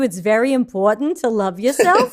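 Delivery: comic, condescending New York accent. Shown as a demonstration. Mocking a self-help audiobook for the audience, exaggerated New York accent. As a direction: lay on the New York vowels, lecturing. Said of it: "in a New York accent".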